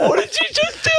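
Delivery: very high squealed question through laughter; retold shocked scolding. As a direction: act out a scandalised parent, voice shooting up. Retells shocked scolding of his son.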